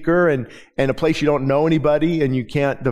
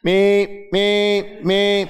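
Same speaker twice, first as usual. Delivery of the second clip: car alarm imitation; 5 semitones higher, much slower than usual. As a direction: bleat it like a car alarm going off. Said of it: "when you let it run its full course".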